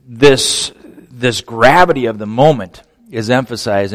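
usual speaking voice

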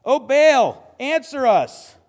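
loud, high cry to a god; yelling out. Acted. False prophets call on Baal, yelling out. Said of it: "they start yelling out".

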